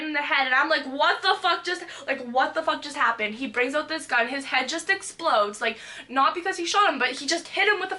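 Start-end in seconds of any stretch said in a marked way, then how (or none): 0.9-3.3 shocked outcry. Retold or imitated. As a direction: blurt it out loud in shock. Retells shock at sudden violence.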